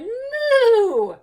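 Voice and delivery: high, loud stretched-neck moo; crying moo. A narrator's reading. Hen stretches her neck and cries moo. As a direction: cry out a moo. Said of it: "stretched her neck and cried".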